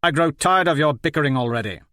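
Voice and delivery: sharp, fast, irritated snap; snapping. A narrator's reading. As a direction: cut them off impatiently. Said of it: "Eros snapped".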